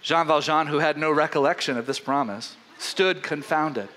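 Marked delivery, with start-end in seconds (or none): none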